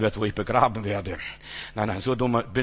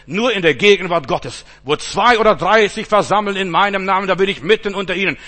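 louder and quicker with each repeat; building litany; 4 semitones higher, 6 dB louder than usual. Acted. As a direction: drive the refrain home harder each time.

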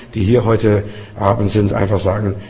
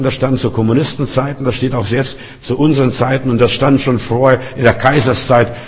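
ordinary talk

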